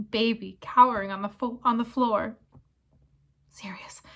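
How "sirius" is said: breathy whispered name. Acted. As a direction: whisper through tears. Pettigrew whispers sobbing.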